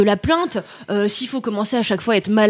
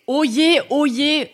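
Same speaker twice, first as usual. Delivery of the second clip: town crier; 5 semitones higher than usual. Acted. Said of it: "la ménestrelle du seum".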